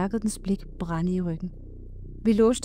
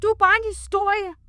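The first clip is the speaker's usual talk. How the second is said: high, defiant yell; high-pitched shout of disbelief; scream; 11 semitones higher, 4 dB louder, faster than usual. A narrator's reading. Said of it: "skreg jeg mod egetræet".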